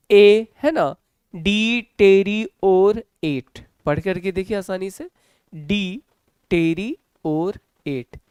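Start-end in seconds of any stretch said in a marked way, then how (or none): 1.4-3.7 "डी टेरी और एट" very slow, in four separate sounds; syllable by syllable. Shown as a demonstration. Teacher showing students the four sounds, "डी टेरी और एट" slowly split. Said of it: "ये चार अलग-अलग sounds हैं". 5.5-8.2 "डी टेरी और एट" in four slow pieces with gaps; syllable by syllable. Shown as a demonstration. Teacher helping students read, "डी टेरी और एट" broken into syllables. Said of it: "ये चार अलग-अलग sounds हैं".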